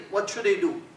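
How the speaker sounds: normal talking voice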